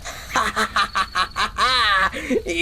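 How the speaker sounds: long villainous cackle; gloating laugh. Acted. Schemer laughing over the farmer's ruin, gloating laugh. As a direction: laugh with malicious glee. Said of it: "পরে হাসিস".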